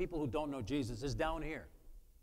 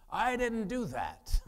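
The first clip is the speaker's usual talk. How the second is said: slow, higher, louder I didn't do that; emphatic denial; 7 semitones higher, 7 dB louder, much slower than usual. Retold or imitated. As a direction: spell out I didn't do that slowly and firmly. Insists innocence to offended friend.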